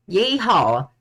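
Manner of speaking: loud Southern yeehaw warm-up; high, loud cowboy-style Southern holler; Southern accent. Acted. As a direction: whoop it in a hammed-up Southern accent. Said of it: "I can't do a southern accent".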